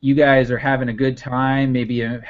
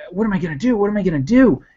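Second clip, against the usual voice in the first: rising panicked repeat, higher; panicking; 6 semitones higher, much faster than usual. Acted. Racer panicking over missing mounts, frantic and higher. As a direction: fret higher each time. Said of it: "He's panicking".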